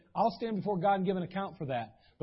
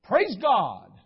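forced praise; 2 semitones higher, 7 dB louder, much slower than usual. Retold or imitated. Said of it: "I'd be like".

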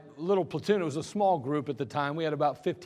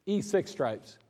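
ordinary talk

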